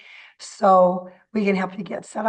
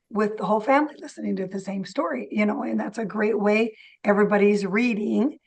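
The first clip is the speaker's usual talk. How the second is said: ordinary talk, nothing marked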